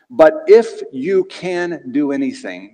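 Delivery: slow, leaning hard on "if"; stress on if. Shown as a demonstration. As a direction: draw out "if" to show the doubt. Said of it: "y'all say if".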